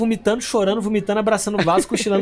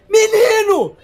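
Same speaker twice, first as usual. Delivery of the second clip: high loud frantic shouting mother; mother shouting at her son on the phone; desperate mom; 14 semitones higher, 7 dB louder than usual. Retold or imitated. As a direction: yell like a panicked mother on the phone. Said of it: "desesperada, falando assim".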